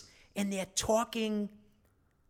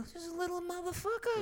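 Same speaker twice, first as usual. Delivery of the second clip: high, fast, softer disbelief; exasperated aside; 11 semitones higher, 8 dB quieter, faster than usual. Retold or imitated. Buyer marveling at the tough teen seller, high and fast. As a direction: mutter in amazed outrage.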